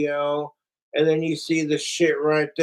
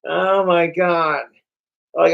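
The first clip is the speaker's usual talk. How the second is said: ordinary delivery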